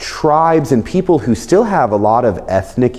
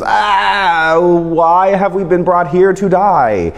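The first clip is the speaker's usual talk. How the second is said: whining congregation; 7 semitones higher, slower than usual. Retold or imitated. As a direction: moan it like a scared crowd. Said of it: "the congregation goes".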